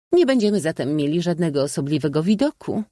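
high, quick lady's voice; princess. A narrator's reading. Princess remarking on the view to guests, high and quick. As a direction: raise the pitch and speak briskly.

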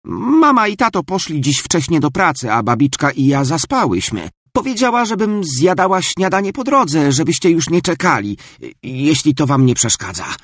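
high breathless excuses; rushed chatter. Acted. Marta explains to the family, high and rushed. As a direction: tumble out the excuses.

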